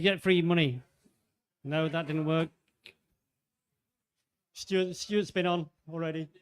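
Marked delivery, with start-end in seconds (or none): none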